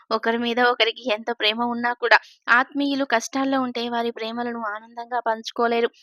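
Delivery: ordinary delivery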